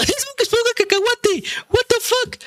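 very high squeaky laughing cry; laughing disbelief. Real, not acted. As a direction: cackle out the absurd reveal. Laughing disbelief at SpongeBob reveal.

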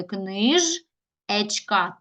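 "книжечка" slowly as книж, pause, ечка; wrong word split. Shown as a demonstration. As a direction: break "книжечка" wrongly between ж and е. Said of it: "Так, ми не переносимо".

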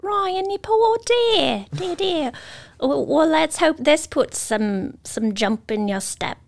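put-on British accent. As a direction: play the fussing British lady. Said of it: "British Debbie".

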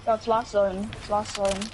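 usual speaking voice

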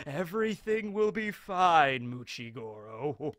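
slow, drawn-out Baron voice; sly reassurance. Retold or imitated. Baron's sly reassurance after a chuckle.

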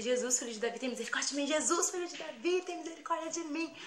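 high-pitched chanted plea to heaven; frantic crying out. Retold or imitated. Girl screaming for Jesus's mercy during robbery, frantic. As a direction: wail it out like a desperate prayer. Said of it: "Aí eu comecei a gritar".

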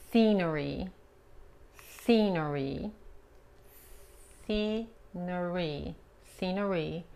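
scenery said slowly with an English R; English pronunciation model. Shown as a demonstration. Teacher modeling "scenery" to Spanish-speaking students, slow. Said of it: "La R es importante pronunciar la R correctamente".

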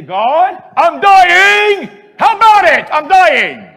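loud, high plea; honest cry to God. Pretend. Believer to God, loud desperate plea. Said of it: "You say".

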